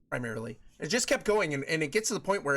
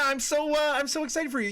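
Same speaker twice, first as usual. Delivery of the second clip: ordinary delivery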